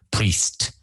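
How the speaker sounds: priest said as one crisp syllable. Shown as a demonstration. Teacher showing learners priest as one syllable. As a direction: say priest in a single beat. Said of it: "Priest, one syllable".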